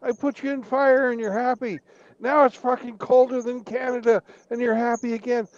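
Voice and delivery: Satan scolding the Canadians, high and fed up; exasperated Satan. Acted. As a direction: whine at the Canadians in disbelief. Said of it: "And Satan goes".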